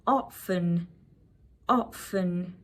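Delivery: "often" with a silent t, OFF-en; British pronunciation. Shown as a demonstration. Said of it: "you can pronounce it like".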